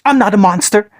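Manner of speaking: put-on high, choked sob of a child. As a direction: force it out through the tears. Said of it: "he choked out".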